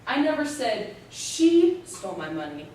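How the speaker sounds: "she" punched loud and set apart; stress on she. Shown as a demonstration. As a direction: hit the word "she" hard. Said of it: "You can stress each word in that sentence".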